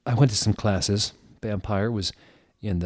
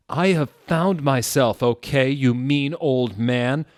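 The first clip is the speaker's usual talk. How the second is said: higher, louder, slow shout; angry yell; 6 semitones higher, 7 dB louder, slower than usual. A narrator's reading. Hagrid yelling at Dumbledore, loud and slow. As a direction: yell it at the old man. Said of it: "Hagrid yelled".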